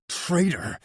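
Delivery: exploding accusation. Acted. Matvi strangles his brother, last "traitor!" exploding. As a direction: let the last "traitor!" burst out. Repeated accusation while choking him.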